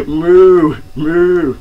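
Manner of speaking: loud high mooing; cow moo. Pretend. Imitating a cow in the song.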